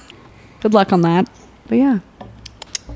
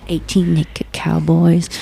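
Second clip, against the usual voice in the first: slow lyric quote; much slower than usual. Retold or imitated. Quoting song lyric slowly.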